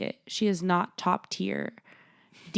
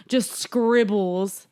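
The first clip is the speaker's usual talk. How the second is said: ordinary talk, nothing marked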